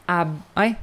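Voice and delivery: ordinary talk, nothing marked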